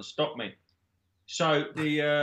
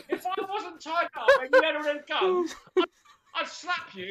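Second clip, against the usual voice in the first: laughing; 14 semitones higher, 4 dB louder than usual. Retold or imitated. Nun voiced, laughing.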